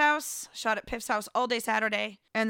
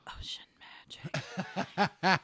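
slow whisper; 3 semitones lower, 4 dB quieter, much slower than usual. Retold or imitated. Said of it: "I'm like".

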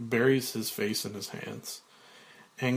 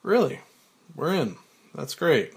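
plain reading voice

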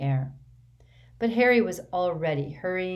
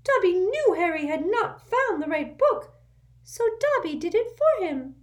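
much higher, louder squeaky elf voice; urgent little servant squeaking; an anxious house elf waking his master; 6 dB louder than usual. A narrator's reading. Dobby voiced.

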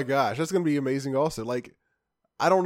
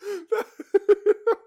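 high laugh; 5 dB louder than usual. Real, not acted. Laughing at joke.

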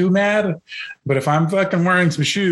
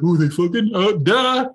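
slow, dim surprised voice with a slightly raised pitch; clueless coworker; 4 semitones higher, slower than usual. Pretend. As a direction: play the clueless coworker who catches on late. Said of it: "you're gonna be like".

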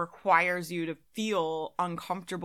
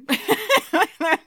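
loud high burst of laughter; 12 semitones higher, 10 dB louder than usual. Real, not acted. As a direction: laugh out at the absurdity. Laughs at own remark.

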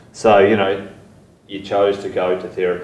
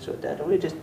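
put-on higher, quicker child's plea; little son's voice; 10 semitones higher, faster than usual. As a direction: lightly voice a small boy explaining it simply. Father voices his young son.

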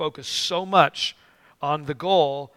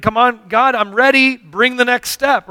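loud, high-pitched impatient plea; mock impatient urging; 7 semitones higher, 7 dB louder than usual. Believer tired of waiting for the next step, impatient. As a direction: hurry God along, louder and higher.

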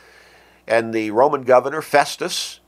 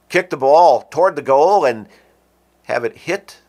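ordinary talk, nothing marked

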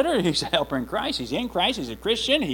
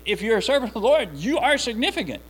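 ordinary talk

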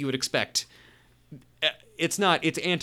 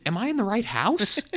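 high, incredulous little question; baffled question; 8 semitones higher, 3 dB louder than usual. Retold or imitated. Tim, baffled by his wife's demand, asking in high disbelief. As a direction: sound like you've walked into the wrong home. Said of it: "He says at one point".

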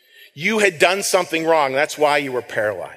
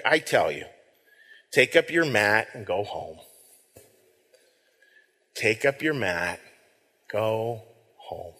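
soft gentle command; 7 semitones lower, 6 dB quieter, slower than usual. Acted. Jesus commands the healed man.

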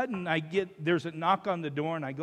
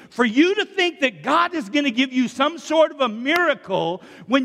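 loud, raised, emphatic pulpit delivery; forceful preaching; 4 semitones higher, 6 dB louder than usual. Real, not acted. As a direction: drive the call to repentance home to the congregation. Preaches repentance at full force.

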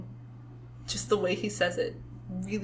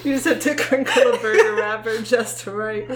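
louder, half-laughing complaint; 2 semitones higher, 8 dB louder than usual. Real, not acted. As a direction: laugh through the joke at yourself. Laughing at their low standards.

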